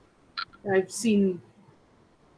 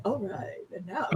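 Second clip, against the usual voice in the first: late-night radio voice; 7 dB quieter than usual. Retold or imitated. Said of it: "nighttime radio caster".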